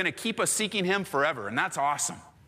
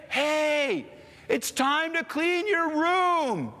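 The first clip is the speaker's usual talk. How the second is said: slow, drawn-out, higher fed-up dad; weary dad; 11 semitones higher, much slower than usual. Retold or imitated. Dad telling the kids yet again to clean, slow and weary. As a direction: drag every word like the umpteenth time. Dad's final room demand.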